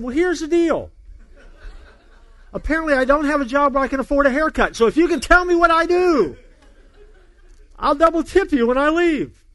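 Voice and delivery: high, loud comic exasperation; hammed-up fed-up announcement, stretched at the end; exasperated challenge. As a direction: lay down the bet in mock indignation. Fed up, repeats his bet.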